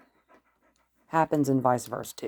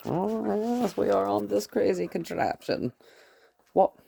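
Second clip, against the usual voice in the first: much higher, sing-song voice to the dog; bright pet-talk, pitched up; playful dog talk; 7 semitones higher than usual. Real, not acted. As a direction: tease the dog happily.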